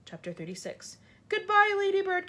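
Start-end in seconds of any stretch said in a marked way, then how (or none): 1.3-2.3 high, louder despairing goodbye; gasped farewell. A narrator's reading. James clinging to the falling peach, gasped farewell. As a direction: call out a last farewell. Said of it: "gasped James".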